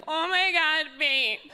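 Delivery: high, loud gushing swoon; mock swooning girlfriend. Girlfriend mocking boyfriend's honesty, high gushing swoon. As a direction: gush like she's melting for him. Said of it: "supposed to swoon or something".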